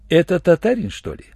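ordinary delivery, nothing marked

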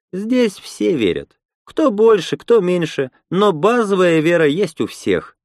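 high-pitched voice for a woman explaining; Lena, earnest believer. Acted.

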